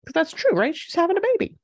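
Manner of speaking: ordinary delivery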